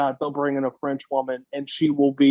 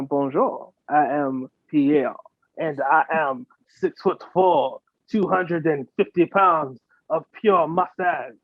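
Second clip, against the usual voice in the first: put-on French accent. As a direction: introduce yourself as a hammed-up Frenchman. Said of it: "with fucking French accent".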